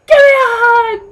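excited shout. Retold or imitated. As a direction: call out for a hug at full volume. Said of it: "Me, I'm like".

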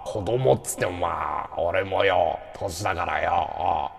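Unachanman impression. Streamer re-creating a voice heard at the takoyaki shop, low impression. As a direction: drop deep and drag the words out, imitating him. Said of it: "再現しようか".

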